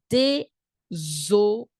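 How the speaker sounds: désolé very slowly, dé, zo, lé; syllable by syllable. Shown as a demonstration. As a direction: pause between each syllable of désolé. Teacher breaks word into syllables.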